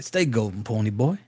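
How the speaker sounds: lightly put-on low voice, softened; quoted movie line. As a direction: drop low to mimic a film line. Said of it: "And he was like".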